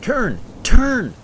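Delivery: high, full-force yell; urgent shout. A narrator's reading. Gunhild to her crew as the storm drowns her out, shouting. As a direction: shout commands over a roaring storm. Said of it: "Gunhild shouted".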